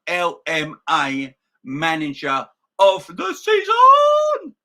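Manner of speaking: crescendo from a slow drawn-out line to a triumphant shout; building to a shout. Real, not acted. Celebrating Klopp's award.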